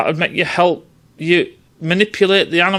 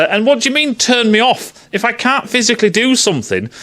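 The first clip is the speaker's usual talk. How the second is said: ordinary talk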